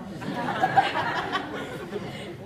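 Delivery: airy breathy chuckle; quick soft laugh at her own joke; light laugh. Real, not acted.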